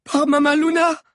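very high, loud cry; high-pitched stunned shout; shocked exclamation. A narrator's reading. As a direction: cry out an oath in horror. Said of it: "s'écria Sabi".

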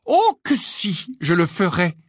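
slow, high, loud menace on oh que si; menacing. Acted. Hermione warns the twins, menacing. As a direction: promise it like a threat. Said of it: "d'un air menaçant".

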